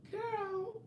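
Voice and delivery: put-on very high soft girl; breathy high exasperated girl; mocking girl. Answering the fan, high soft mock girl. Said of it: "And I be like".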